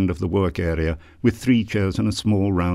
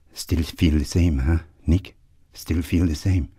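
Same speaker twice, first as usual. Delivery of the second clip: gentle Irish brogue. A narrator's reading. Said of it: "the gentlest Irish brogue".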